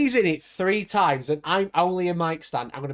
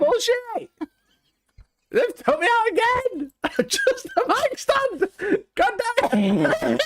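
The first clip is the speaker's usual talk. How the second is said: high shrieking laugh; laughing outrage; slower than usual. Real, not acted. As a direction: cry out laughing in protest. Cut out of video again.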